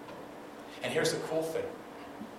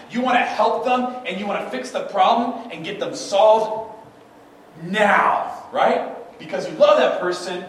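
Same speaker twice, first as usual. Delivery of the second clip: louder, higher, pressing preacher build; rising urgency; 7 semitones higher, 8 dB louder, slower than usual. Acted. To the congregation about loved ones, louder and higher. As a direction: push the urgency up, pleading for them to be fixed.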